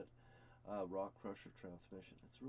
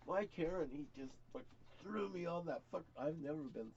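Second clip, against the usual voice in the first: normal talking voice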